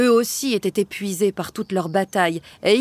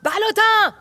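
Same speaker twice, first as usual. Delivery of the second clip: loud, much higher yell; yelling a name; 13 semitones higher, 4 dB louder than usual. A narrator's reading. King yelling for Ballotin, loud call. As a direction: call him out sharply. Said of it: "hurla-t-il".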